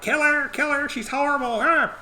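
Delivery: high shrill-voiced mob women, hammed up; mock shrieking crowd women. Townswomen screeching at Hester from the crowd, mock high voices. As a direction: squeal it like a bloodthirsty crowd.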